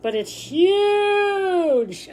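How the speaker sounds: high, very slow stretched huge; drawn-out huge. Shown as a demonstration. Teacher showing students how big, slow and high. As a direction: stretch out huge to show size. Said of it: "you wanna show".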